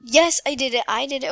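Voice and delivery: loud relieved victory; excited. Real, not acted. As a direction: cheer the win. Beats level.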